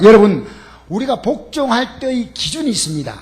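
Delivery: ordinary delivery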